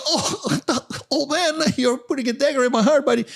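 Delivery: high, mock-wounded sarcasm; teasing the angry driver with high mock hurt. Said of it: "I go".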